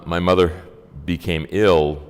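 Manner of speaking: ordinary delivery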